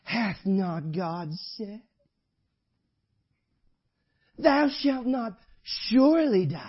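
slow, drawn-out sly tempter; sly devil voice. Retold or imitated. Devil tempting the believer, in a slow sly drawl. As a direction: draw it out like a serpent whispering. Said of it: "kind of in a little sly voice".

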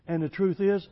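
loud, high, driving pulpit voice; fiery preaching. Acted. Preacher to church, loud fiery sermon delivery. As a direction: preach it hard to the congregation.

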